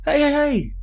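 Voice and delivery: high, indignant hey hey hey; squeaky mock outrage; mock protest. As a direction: placate her, offended. Player protests to woman.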